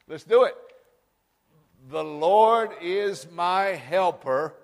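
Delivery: very slow, loud, drawn-out proclamation; bold declaration. Shown as a demonstration. Leading the congregation to say it boldly, slow and loud. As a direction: say it boldly, stretching every word. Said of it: "we may boldly say, we better say it".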